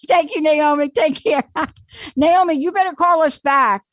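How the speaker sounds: loud, high-pitched, laughing farewell; laughing sign-off. Real, not acted. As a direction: send the caller off through laughter. Host laughing as caller signs off.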